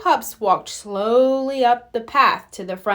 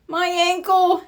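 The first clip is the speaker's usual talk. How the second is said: pained exclaim; 7 semitones higher than usual. A narrator's reading. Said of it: "he exclaimed".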